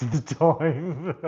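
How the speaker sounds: words tipping over into a long, helpless laugh; real laughing. Real, not acted. Streamer admitting he can't understand a Scottish channel, laughing. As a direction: break up laughing as the sentence ends.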